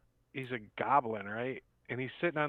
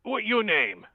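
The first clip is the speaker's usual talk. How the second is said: high, loud goblin voice; goblin asking; 9 semitones higher, 7 dB louder, slower than usual. Acted. Goblin asks the newcomer's name, high loud goblin voice. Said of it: "and he's like".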